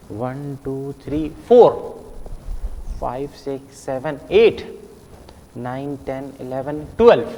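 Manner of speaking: soft, low count with फोर, एट, twelve punched out; every fourth number stressed. Shown as a demonstration. Teacher counting every fourth one for pupils, stressing फोर, एट, twelve. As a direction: murmur three numbers, then hit फोर, likewise एट and twelve. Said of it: "हर चौथे को गिनना है".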